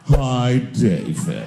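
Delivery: low, very slow, sly purr; catty drawl. Retold or imitated. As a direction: purr it like a smug cat. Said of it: "the way a cat would speak if a cat could talk".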